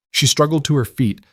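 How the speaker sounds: ordinary delivery, nothing marked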